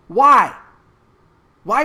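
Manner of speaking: a sudden loud shout of why. Real, not acted. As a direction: blurt it out as an angry shout. Angry rant at officials.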